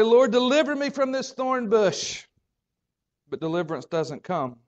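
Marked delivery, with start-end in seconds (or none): 0.0-2.2 loud pleading cry to the Lord, pitched high; desperate prayer. Pretend. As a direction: cry out for deliverance from the thorn.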